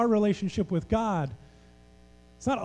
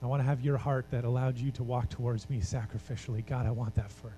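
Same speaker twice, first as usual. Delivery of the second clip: lower, softer prayerful voice; quiet prayer; 6 semitones lower, 6 dB quieter than usual. Acted. A preacher leading the congregation in prayer, quiet and earnest. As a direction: pray it softly and earnestly to God.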